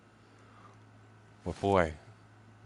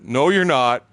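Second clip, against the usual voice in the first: firm; 6 semitones higher, 9 dB louder, slower than usual. Retold or imitated. Wife voice retold.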